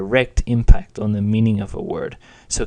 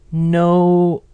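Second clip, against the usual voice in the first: high, loud, held flat no; high level no; 7 semitones higher, 6 dB louder than usual. Shown as a demonstration. Weatherman doubtfully answering, high level no. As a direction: sustain no high and even. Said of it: "That's a high-pitched level tone".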